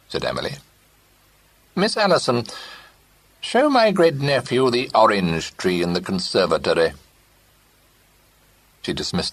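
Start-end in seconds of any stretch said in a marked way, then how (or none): 1.7-6.9 put-on high, imperious old lady order; commanding old aunt. A narrator's reading. Great-aunt ordering her companion, imperious.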